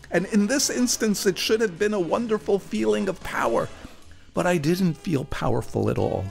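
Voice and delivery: usual speaking voice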